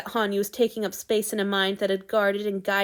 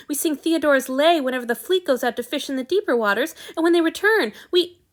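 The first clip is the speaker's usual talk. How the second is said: tumbling out higher and louder, gushing; a fan who can't stop talking about his hero; sudden excitement; 7 semitones higher, 5 dB louder than usual. A narrator's reading. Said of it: "in his sudden excitement".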